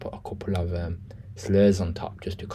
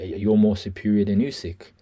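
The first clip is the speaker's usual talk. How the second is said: normal talking voice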